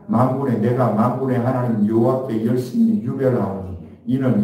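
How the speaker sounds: steady, measured Bible reading to the church; scripture reading. Acted. Pastor reading scripture to the congregation, measured. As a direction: read the verse aloud, even and formal. Reads verse, repeats "만군의.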